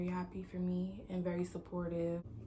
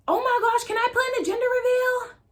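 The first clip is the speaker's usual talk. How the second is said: high, loud squeal of delight; mom's excited reaction; 12 dB louder than usual. Retold or imitated. Mom hearing her daughter is pregnant, excited squeal. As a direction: imitate an overjoyed mom squealing.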